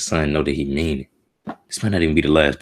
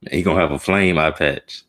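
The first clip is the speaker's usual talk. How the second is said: normal talking voice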